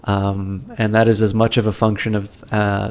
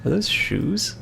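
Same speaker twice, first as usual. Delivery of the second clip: surprised question; 10 semitones higher, 6 dB quieter, slower than usual. Real, not acted. Spotting something in the game.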